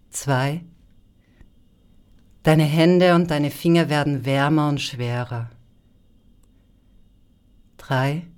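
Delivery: slow hypnotic induction voice; calm guided hypnosis; sleep story narrator. Acted.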